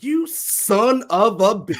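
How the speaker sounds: drawn-out, high, loud mock outrage; hammed-up "well, look who it is", stretched out and laughing; acted-out recognition. Retelling a childhood friends' meet cute, slow loud mock outrage. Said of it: "she just kinda, like, turns and she's like".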